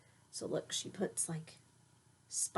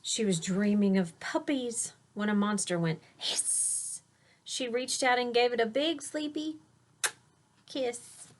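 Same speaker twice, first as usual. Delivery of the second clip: put-on lilting rhyme read to a child; read-aloud storytelling; 6 semitones higher than usual. A narrator's reading. Parent reading a rhyming bedtime book aloud to a child. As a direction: read the verse with a gentle bounce.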